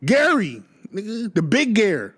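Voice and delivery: high, proud hometown boast; imitating Michael. Michael claiming Gary to anyone listening, high mock boast. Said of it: "I hear Michael like".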